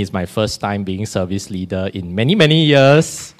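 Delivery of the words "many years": ordinary delivery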